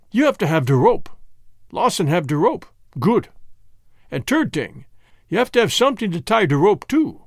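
put-on emphatic Germanic-accented bargainer; thick foreign accent, lively and insistent; Jan with Germanic accent. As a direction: lecture them in broken English. Jan explains hanging step by step.

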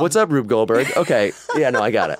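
laughing through a loud mock quote. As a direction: crack up while quoting. Acted quote, mocking crew's surprise.

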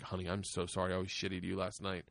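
quiet, contrite parent voice; retold apology. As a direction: apologize gently, keeping it low. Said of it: "And I apologized to Georgia".

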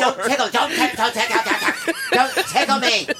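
giggling through the words; laughing. Retold or imitated. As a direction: laugh while protesting. Laughing tickled wife voiced.

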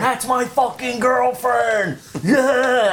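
high, drawn-out mock holler; hyped strip-club guy. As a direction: imitate a guy proudly hollering over the crowd. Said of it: "the guy next to me is going".

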